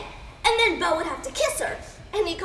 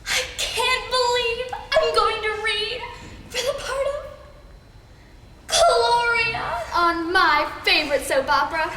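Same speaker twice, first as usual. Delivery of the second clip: gushing excitement; 6 semitones higher than usual. Acted. Fan about to audition for her favorite soap, gushing excitement. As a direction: gush it, stretching the big moments.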